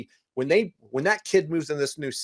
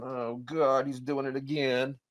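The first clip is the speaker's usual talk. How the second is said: softer embarrassed groan of a wife; a hint of an embarrassed mother, softer; weary wife; 6 dB quieter, slower than usual. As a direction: mutter it under your breath, fed up. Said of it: "the wife's over here going".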